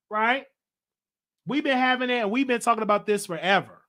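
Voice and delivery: loud, high, fired-up livestream energy; hyped-up host. Real, not acted. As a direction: rev up the audience, all energy. Hyped-up host addressing viewers.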